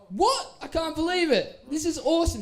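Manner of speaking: put-on a kid who just found the jersey he wanted; thrilled disbelief. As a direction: squeal the news way up high, delighted. Retelling his own thrilled reaction.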